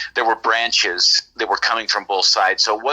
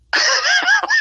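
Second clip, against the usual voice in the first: sudden high-pitched laugh; a giddy laugh of disbelief; burst of laughter; 3 dB louder than usual. Real, not acted. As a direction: break into a high, startled laugh. Stunned reaction to the sighting.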